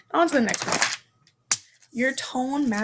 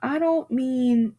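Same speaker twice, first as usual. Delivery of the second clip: usual speaking voice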